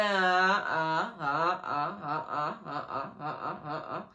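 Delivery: put-on low, trailing drunken babble; slurring drunk friend. Imitating her drunk friend who can't keep focused, slurring babble. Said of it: "she's doing all of this".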